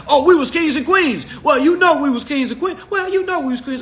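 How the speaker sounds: high-pitched mock boasting; mocking parroting. People claiming we was kings and queens, mockingly parroted.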